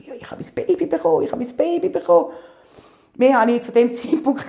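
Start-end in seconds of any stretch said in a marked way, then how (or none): 0.1-2.3 higher and fast, blurting it out; surprised mother blurting to the emergency operator; stunned excitement. Retold or imitated. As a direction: gasp out the news in disbelief. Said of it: "Ich bin so überrascht gewesen".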